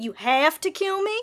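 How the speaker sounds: usual speaking voice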